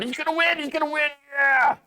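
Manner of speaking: mock fan cheer. Mocking believers chanting victory.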